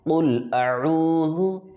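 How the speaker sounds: Quran recitation. Shown as a demonstration. Teacher moves on to next verse, slow high recitation. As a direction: draw out "قل اعوذ" slowly and high. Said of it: "آگے پڑھیے".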